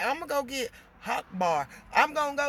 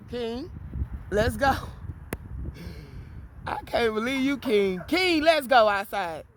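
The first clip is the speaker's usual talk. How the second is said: louder, coaxing call to King; calling the dog; 4 dB louder than usual. Real, not acted. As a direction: urge the dog out the door, loud.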